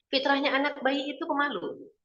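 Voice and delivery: ordinary talk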